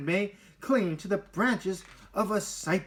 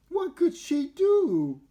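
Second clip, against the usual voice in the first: worried; 13 semitones higher, 6 dB louder, slower than usual. A narrator's reading. Narrator voicing Olivia's worry.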